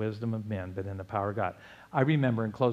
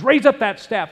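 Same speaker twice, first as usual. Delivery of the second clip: commanding God; 10 semitones higher, 10 dB louder than usual. Retold or imitated.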